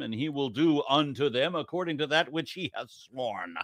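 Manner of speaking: slow solemn sermon, swelling to a shout; booming preacher voice. Acted. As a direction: boom it out like a preacher at the pulpit. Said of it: "right up there with Charlton Heston with the booming voice thing".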